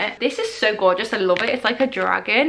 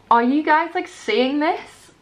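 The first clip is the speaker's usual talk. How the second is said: excited; 5 semitones higher, slower than usual. Real, not acted. Showing lamp to viewers.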